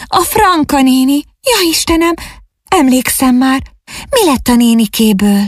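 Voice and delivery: put-on high, alarmed girl's voice; frightened exclamation. A narrator's reading. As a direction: cry out as the dreadful memory returns. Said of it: "kiáltott fel ijedten". (ijedt, magas hangú kétségbeesés)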